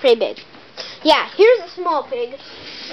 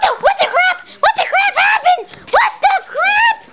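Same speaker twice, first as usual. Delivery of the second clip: shocked shout. Pretend. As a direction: yell in playful disbelief. Shocked at the scene.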